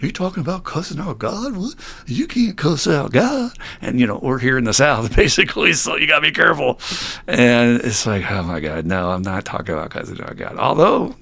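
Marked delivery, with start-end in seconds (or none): 0.0-3.5 high, soft, incredulous mimicry; mock-scandalized listener questioning in a raised voice; baffled disbelief. Speaker imitating shocked meeting listeners, baffled disbelief. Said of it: "this weird look on their face". 8.3-10.6 low, quieter exasperated aside; dropped-pitch mock exasperation; weary denial. Speaker answering shocked listeners, weary denial.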